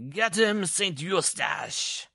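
loud, high call stretched on the name; high, clear command. A narrator's reading. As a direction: sic the dog on him, ringing out. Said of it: "added in a high, clear voice".

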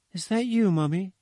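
put-on high, slightly curious little child's voice; small anxious child asking for mother. A narrator's reading. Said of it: "slightly curious and anxious voice of a child".

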